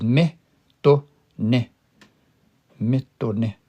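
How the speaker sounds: me, to, ne as three separate syllables. Shown as a demonstration. Teacher breaking the word into three syllables, syllable by syllable. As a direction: split it into me... to... ne with clear gaps. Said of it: "breaks up into three syllables".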